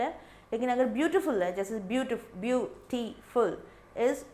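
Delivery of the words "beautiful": "beautiful" broken slowly into three separate syllables. Shown as a demonstration. Teacher counts syllables for students, "beautiful" split up slowly. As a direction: say "beautiful" in slow, distinct syllable beats. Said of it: "three syllable".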